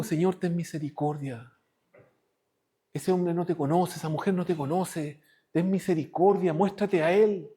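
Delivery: low, soft plea for mercy; merciful prayer. Pretend. Believer kneels and asks the Lord for mercy, low and soft. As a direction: pray humbly for the enemy.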